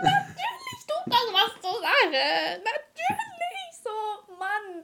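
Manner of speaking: very high, drawn-out mock wail; empörtes Jammern. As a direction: whine it hugely exaggerated, half laughing. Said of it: "Und ich so". (übertrieben hohes, gedehntes Jammern)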